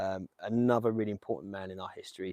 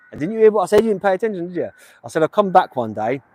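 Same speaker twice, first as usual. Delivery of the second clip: loud, higher-pitched, indignant telling-off; bickering; 7 semitones higher, 11 dB louder than usual. Real, not acted. Streamer squabbles with his mate Lee, indignant bickering. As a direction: have a go at your mate, raised voice.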